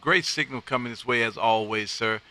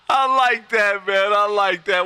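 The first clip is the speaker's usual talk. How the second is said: laughing; 4 semitones higher, 4 dB louder than usual. Real, not acted. Laughing at the pig joke.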